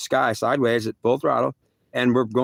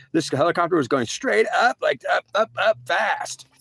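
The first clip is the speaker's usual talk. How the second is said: rising pitch; 6 semitones higher than usual. Retold or imitated. Helicopter shooting straight up.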